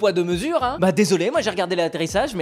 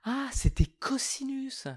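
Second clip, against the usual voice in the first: slow, whispered sheepish aside; whispering; 8 semitones higher, 7 dB quieter, slower than usual. Acted. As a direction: whisper it like a confession.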